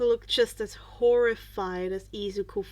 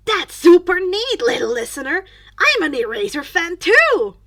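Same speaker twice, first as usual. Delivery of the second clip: cheerful teacher; 5 semitones higher, 13 dB louder than usual. Acted.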